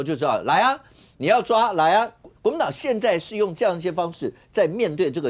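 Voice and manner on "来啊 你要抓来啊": higher, louder mock challenge; retold defiant dare. Host retelling a spokesperson's challenge to be arrested, defiant dare. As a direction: quote a defiant "come and get me". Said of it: "意思就是刚才易善讲说".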